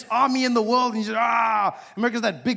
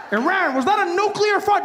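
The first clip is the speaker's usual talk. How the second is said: alarmed shout; 11 semitones higher, 4 dB louder than usual. Retold or imitated. America confronts Iran.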